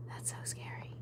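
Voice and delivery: hushed, voiceless repeat to herself; whisper. Real, not acted. Streamer to herself about the story, scared whisper. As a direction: whisper it, spooked.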